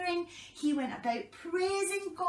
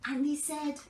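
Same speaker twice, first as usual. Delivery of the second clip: ordinary delivery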